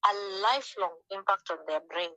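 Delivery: ordinary talk, nothing marked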